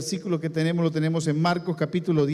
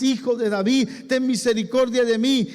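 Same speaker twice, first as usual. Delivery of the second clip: higher, louder plea for mercy; blind man crying out; 7 semitones higher, 4 dB louder than usual. A narrator's reading. Bartimeo begging Jesus for mercy, higher, louder cry. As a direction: cry out to be heard over the crowd. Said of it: "clamaba mucho más".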